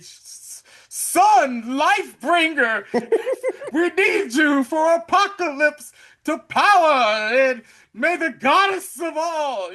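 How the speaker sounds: high, slow, grand goddess proclamation; lofty mock-heroic speech, slow and soaring; dramatic Storm monologue. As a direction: ham up a superhero's solemn invocation, way up high and loud. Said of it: "Storm be giving these monologues".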